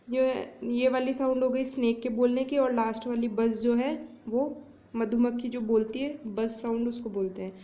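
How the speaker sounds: usual speaking voice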